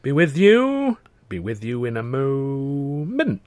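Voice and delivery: high distracted clerk, slowing to a drawl; muttered, distracted. A narrator's reading. Clerk busy with forms mutters to visitor, high then slow. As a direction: mutter it without looking up. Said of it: "he muttered without looking up".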